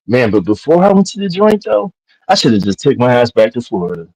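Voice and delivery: usual speaking voice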